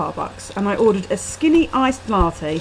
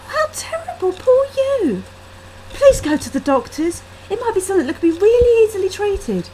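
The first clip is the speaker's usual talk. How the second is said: tearful pity; 11 semitones higher, 4 dB louder than usual. A narrator's reading. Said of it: "She had tears in her eyes".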